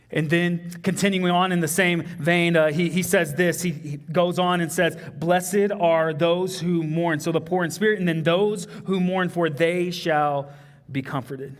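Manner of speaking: fervent sermon delivery; preaching. Acted. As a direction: lift the congregation with it. Preacher building to full voice.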